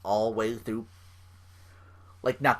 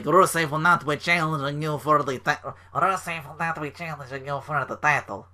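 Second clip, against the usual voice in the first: bad Russian accent; 3 semitones higher than usual. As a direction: imitate a thick-accented Russian making a proclamation. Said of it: "really bad stereotypical Russian accent".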